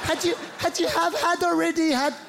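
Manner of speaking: high-pitched, halting grammar struggle; confused fumbling. Acted. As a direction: stumble upward through the verbs, unsure. Stumbling over confusing past tense.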